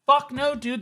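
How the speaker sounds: ordinary talk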